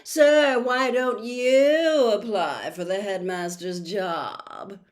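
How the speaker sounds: loud, slow, fawning sneer; butter up the teacher loudly; Malfoy loudly flattering Snape; loud smug Malfoy. Acted. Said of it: "said Malfoy loudly".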